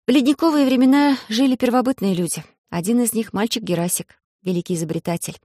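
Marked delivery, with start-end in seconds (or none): none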